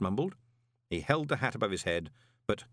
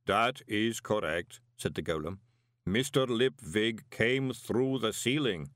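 slow, even, strange golem voice; calm and measured like a clay man; golem Pump; slower than usual. A narrator's reading. Golem Pump telling Moist about the boots, slow and even.

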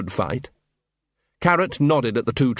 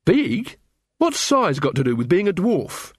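higher-pitched challenge; demanding; 6 semitones higher than usual. A narrator's reading. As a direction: demand an answer, mildly indignant. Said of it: "Carrot demanded".

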